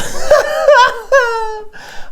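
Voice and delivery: high cackling laugh; cackle. Acted. Laughs at own screaming story.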